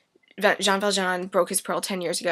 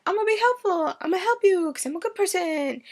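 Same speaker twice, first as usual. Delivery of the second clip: much higher, sugary fake-nice voice; mock sweet innocence; 11 semitones higher, faster than usual. Javert pretending to help at the barricades, sugary high fake sincerity. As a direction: play it falsely sweet and innocent. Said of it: "he's all like".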